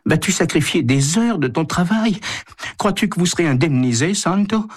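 high-pitched wifely reproach; plaintive. A narrator's reading. Wife challenges Santo about lost working hours, plaintively. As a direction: question him in a high, plaintive voice. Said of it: "lui parle sur ce ton plaintif".

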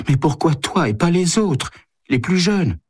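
high, complaining wife's voice; plaintive. A narrator's reading. Wife objects to Santo volunteering, plaintively. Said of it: "lui parle sur ce ton plaintif".

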